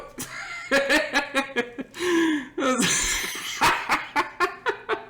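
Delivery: long, high cackling laughter; real laughing fit. Real, not acted. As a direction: lose it laughing at your own story. Long laugh at own story.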